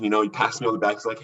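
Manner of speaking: ordinary delivery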